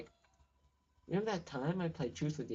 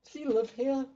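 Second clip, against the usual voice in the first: surprised; 10 semitones higher, 8 dB louder than usual. Real, not acted. Surprised question.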